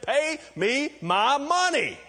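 high, loud, slow demand for money; angry demand. Acted. Forgiven servant throttling a debtor, slow high angry demand. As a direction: growl out the demand while choking him. Servant choking his fellow servant.

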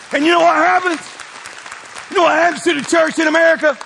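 loud, high challenge to the crowd; full-force preacher's call; preaching shout. Acted. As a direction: hammer the question at them.

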